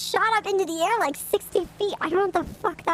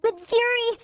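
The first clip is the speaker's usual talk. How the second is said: lightly put-on higher voice; undercover voice; 5 semitones higher than usual. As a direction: talk like a secret agent. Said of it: "Why do you sound like that?".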